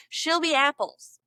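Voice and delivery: usual speaking voice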